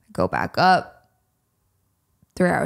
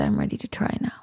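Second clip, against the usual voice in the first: deep imitation; 6 dB quieter, faster than usual. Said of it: "You said".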